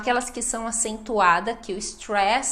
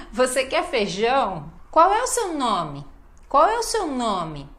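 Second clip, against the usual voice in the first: questions peaking on "feijão" and "nome", then falling; last word stressed. Shown as a demonstration. Teacher showing question emphasis, strong final word "nome". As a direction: lean hard on "NOme" at the end. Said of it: "Olha a ênfase, sempre na última palavra".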